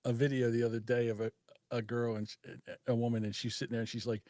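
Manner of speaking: ordinary delivery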